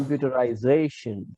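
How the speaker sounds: computerization with a long accented "za"; stress on ZA. Shown as a demonstration. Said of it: "repeat after me".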